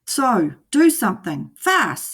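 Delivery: high, pressing fish voice; urgent fish. A narrator's reading. Fish urging the boy to hurry, urgent. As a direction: push him to act now. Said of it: "said the fish".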